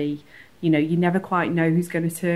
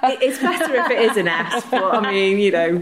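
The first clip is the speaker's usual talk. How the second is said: chuckling through the words; laughing; 5 semitones higher, 7 dB louder, faster than usual. Real, not acted. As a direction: say it while laughing. Joking about place names.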